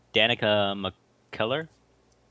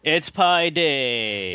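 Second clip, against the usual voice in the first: drawn-out announcement; 5 semitones higher, 4 dB louder, much slower than usual. Acted. Son announces Pi Day.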